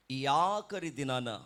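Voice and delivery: ordinary delivery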